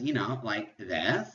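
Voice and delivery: put-on slow Valley Girl drawl ending in an upswing. Shown as a demonstration. Teacher showing friends' chatty inflection, Valley Girl voice. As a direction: let the last word slide way up like a Valley Girl. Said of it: "use the Valley Girl voice".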